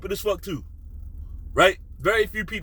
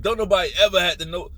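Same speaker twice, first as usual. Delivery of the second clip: normal talking voice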